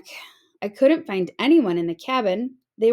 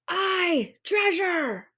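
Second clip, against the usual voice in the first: high cheering pirates; crew cheering; 9 semitones higher, much slower than usual. A narrator's reading. Pirate crew cheers for treasure, high and eager. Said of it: "the others shouted".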